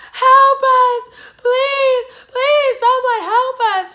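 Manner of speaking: high, loud pleading scream for help; desperate scream. Retold or imitated. Sister screaming at strangers for help, desperate. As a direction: shriek it, begging strangers. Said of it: "My sister's like".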